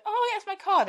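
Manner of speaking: high, loud delighted recognition; hammed-up, high-pitched wonder; mock amazement. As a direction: exclaim like an amazed trick spectator. Voices amazed man seeing his card.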